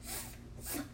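"F-, f-," as a voiceless hiss of air; sounding out the letter. Shown as a demonstration. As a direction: breathe out "F-, f-," with no voice. Teacher sounds out first letter.

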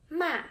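"ma" falling sharply from high; fourth tone ma. Shown as a demonstration. Teacher showing learners the fourth tone, sharp falling "ma". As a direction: drop "ma" hard from the top. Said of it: "five kinds of Chinese tones".

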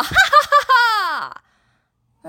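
exaggerated ha-ha-ha jeer; high mocking cackle. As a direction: laugh at her like a bully. Boys laughing at Mulan.

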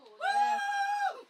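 high, slow, loud cry; excited shout. Real, not acted. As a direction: yell it with glee. Excited outburst.

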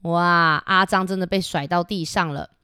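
plain reading voice

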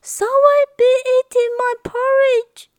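high, fretful mother bear voice; fretting Mama Bear. Acted. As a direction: fret over the porridge like an anxious mother bear. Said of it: "fretted Mama Bear".

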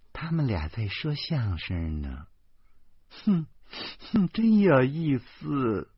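slow, amused character voice; lightly voiced delight. A narrator's reading. Yanming happily tells the wife it's like crosstalk, amused. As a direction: chuckle through the nose and stretch the words. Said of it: "他喜气洋洋地拉着老婆说".

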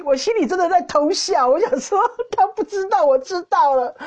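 high, giggly inner thought; secretly gleeful. Retold or imitated. Speaker gloats over knowing his hiding place, secretly gleeful.

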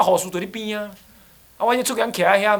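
usual speaking voice

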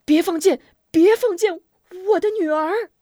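high, loud father's panic; urgent, hurried shout. A narrator's reading. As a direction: shout desperately to halt the archers. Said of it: "急忙喊道".

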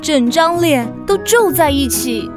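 normal talking voice

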